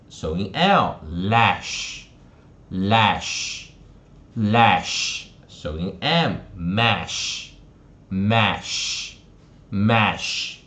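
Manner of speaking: slow, separated repeats with front-A "ash" as in cat; slow phonics drill. Shown as a demonstration. Teacher reviews rhyming words with students, slow three-times "ash" drill. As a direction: spell the onset, then each -ash word three times. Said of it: "我们来复习一下哈".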